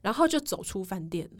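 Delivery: ordinary talk, nothing marked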